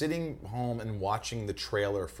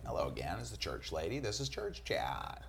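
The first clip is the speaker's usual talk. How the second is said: prim, softer Church Lady greeting; sweetly sanctimonious host voice; 7 dB quieter than usual. Retold or imitated. Reenacting SNL for parents as Church Lady, prim and soft.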